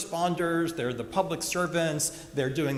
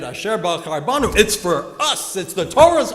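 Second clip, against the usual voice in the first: imitating Carl blurting a translation, louder and higher; eager interruption; 5 semitones higher, 11 dB louder than usual. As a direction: cut in loudly and eagerly, climbing to a near-shout. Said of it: "Carl would interrupt with translation".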